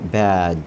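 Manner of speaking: बैड with an open a sound, like "bad"; clear a vowel. Shown as a demonstration. Teacher showing the a sound in बैड. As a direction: say बैड with the a between consonants. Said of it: "तो ए की आवाज़ होगी".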